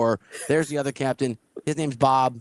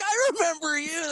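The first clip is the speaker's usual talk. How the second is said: much higher, chirpy voice; high mock greeting; 14 semitones higher than usual. Greeting a guy he'd beaten, in a much higher mock voice. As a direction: squeak it like a cheerful old rival.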